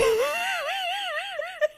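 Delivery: high wailing laugh-sob that breaks into a cry. Real, not acted. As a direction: let out a real laugh-sob, high and strained. Overwhelmed by sad music video.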